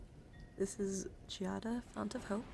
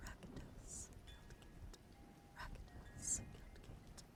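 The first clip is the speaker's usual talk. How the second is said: soft whispered repetition, slower and softer; ASMR whisper; 13 dB quieter, much slower than usual. Real, not acted. ASMR streamer showing a card to viewers, whispered. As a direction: whisper the name, fading away.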